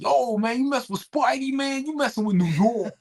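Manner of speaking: put-on high, bright-eyed New York accent, hyped up; New York extra. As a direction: play a fired-up New York movie extra. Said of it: "like, background actors in the Spider-Man movies".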